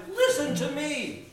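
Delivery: high, insistent appeal; mild shout. A narrator's reading. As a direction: call out for attention. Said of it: "she shouted".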